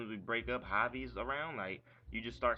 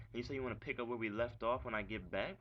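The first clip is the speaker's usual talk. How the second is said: ordinary delivery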